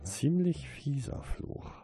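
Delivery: high, slow, tight anger; strained, held-in fury; through gritted teeth. A narrator's reading. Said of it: "zwischen zusammengebissenen Zähnen".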